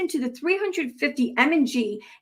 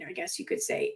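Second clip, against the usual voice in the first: breathy under-the-breath aside; whispering to herself, softer; whispered aside; 11 dB quieter than usual. Real, not acted. Reading a post, she whispers an aside.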